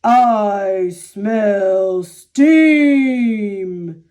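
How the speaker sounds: very slow, drawn-out giant voice; booming giant. A narrator's reading. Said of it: "His thunderous voice boomed".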